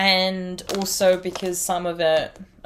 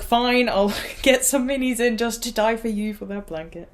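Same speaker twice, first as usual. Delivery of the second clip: a hint of Len's teasing voice, higher, with a laugh; lightly voiced Len, laughing; 4 semitones higher than usual. Retelling Len's reply to her, a touch higher and laughing. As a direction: play Len granting it with a light chuckle. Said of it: "And he was like".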